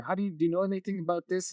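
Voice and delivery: put-on quick, high little-kid voice; lightly voiced curious child; kid's question. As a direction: rattle it off like a curious boy. Toddler nephew asking questions.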